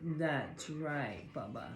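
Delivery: calm the dog down gently; soothing the dog. Real, not acted.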